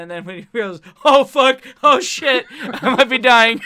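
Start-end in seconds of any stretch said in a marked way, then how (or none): 1.0-2.5 higher and much louder, hammed-up panic; mock freak-out; panicked Batman. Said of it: "hearing a concerned Batman". 2.5-3.6 much louder, laughing through mock panic; half-laughed fake alarm; panicked Batman. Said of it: "hearing a concerned Batman".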